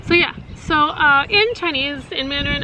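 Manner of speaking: usual speaking voice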